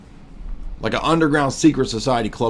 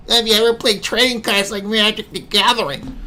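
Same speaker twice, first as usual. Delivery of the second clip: mocking high voice; 10 semitones higher than usual. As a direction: reread much higher as a nerdy mock. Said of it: "Feminist Rapu says".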